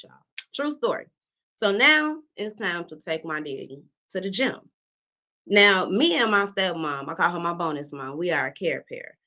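ordinary talk, nothing marked

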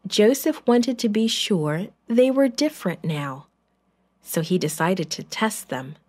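plain reading voice

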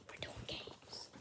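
whispered aside; whispering. Real, not acted. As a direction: whisper it under your breath.

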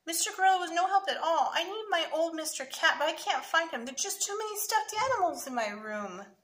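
put-on high, plaintive little-boy complaint; mildly acted upset kid; child crying out. A narrator's reading. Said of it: "Sammy cried".

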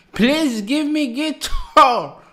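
high, loud, slow begging for the guitar. Real, not acted. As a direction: plead in awe, stretching each please.

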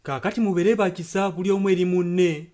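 ordinary talk, nothing marked

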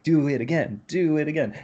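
high, eager "do it again"; eager encore. Retold or imitated. Host mimicking a thrilled audience member, high and eager. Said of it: "like me, you're like".

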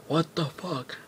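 high startled "what the fuck"; startled outburst. Retold or imitated. Skinhead neighbour finds the scene, startled high outburst. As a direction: jump up in pitch, caught off guard.

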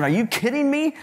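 high, disbelieving mother's voice; the mother can't believe the request; incredulous mother. Retold or imitated. Mother reacting to the photo request.

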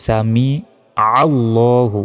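"সামিআল্লাহু" with a throaty ain on "আ"; ain pronounced. Shown as a demonstration. Teacher correcting the ain, slow emphatic pronunciation. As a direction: slowly separate "সামি", then press "আল্লাহু" from the throat. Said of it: "আইনের উচ্চারণ করতে হবে".

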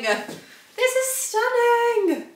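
very high, drawn-out singsong rave; ecstatic gushing. Real, not acted. Vlogger raving about the blazer outfit, high singsong gushing. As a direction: stretch it out high, almost sung. Repeats the gush, far bigger.

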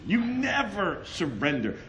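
normal talking voice

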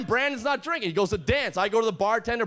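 ordinary talk

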